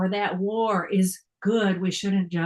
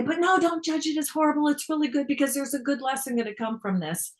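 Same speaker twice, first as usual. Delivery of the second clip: high, fast, sing-song mock of the spiritual ego; lightly mock the know-it-all voice of your own ego; preachy spiritual ego; 6 semitones higher, faster than usual. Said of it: "my spiritual ego is gonna say".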